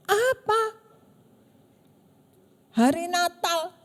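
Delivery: mock the friend's angry message, raising the voice; angry outburst. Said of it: "tiba-tiba dia marah-marah".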